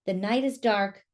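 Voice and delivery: plain reading voice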